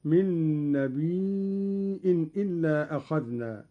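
نبي with a long "ii" then a glottal stop, "nabii'in"; hamza in نبي. Shown as a demonstration. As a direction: hold the ii of نبي, then break into "'in". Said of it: "قرأ قالون بهمز الياء".

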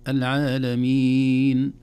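العالمين chanted loud with a long final vowel; longer madd. Shown as a demonstration. Teacher showing madd options, العالمين held longer. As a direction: hold the last vowel of العالمين, slowly. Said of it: "اثنين وأربع وست حركات".

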